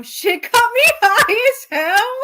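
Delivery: high, loud words through laughter; real laughing fit. Real, not acted. Reader cracking up over the fan fiction, laughing. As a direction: crack up mid-line, slow and squealing.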